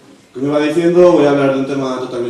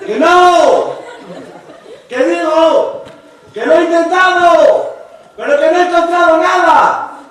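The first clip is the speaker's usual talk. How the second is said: high, loud exasperated yell; insistent shout; 4 dB louder, slower than usual. Retold or imitated. Repeating himself to the friend at the disco, high, loud yell. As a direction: insist it again, shouting over noise.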